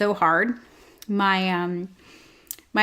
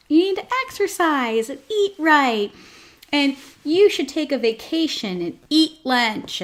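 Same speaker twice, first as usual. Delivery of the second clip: higher-pitched preachy advice voice; mocking advice articles; 5 semitones higher than usual. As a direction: parody a chirpy self-help article. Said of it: "whenever I read those articles".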